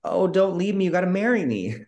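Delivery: high, hammed-up begging; mock desperate plea. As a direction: imitate a clingy heroine pleading. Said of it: "she's like".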